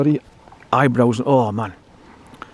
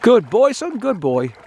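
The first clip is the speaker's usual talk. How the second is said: dog praise; 7 semitones higher, slower than usual. Real, not acted. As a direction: cheer the dog on in a high pet voice. Praising his dog at the water.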